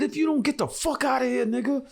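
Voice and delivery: high-pitched exasperated warning; retold angry threat. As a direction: re-enact running someone off, pitch way up. Acted threat to intruder.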